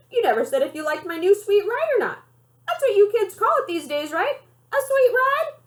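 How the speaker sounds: high, oily salesman pitch on sweet ride; grandma hamming up a slick salesman pitch; smarmy car salesman. A narrator's reading. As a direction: sell it like a slick used car dealer. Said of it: "makes her sound like a smarmy used car salesman".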